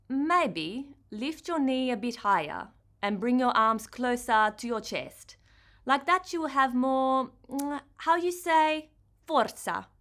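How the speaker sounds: put-on Italian accent, searching for the word. A narrator's reading. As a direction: coach her turn in a careful Italian accent. Valentina coaching in Italian accent.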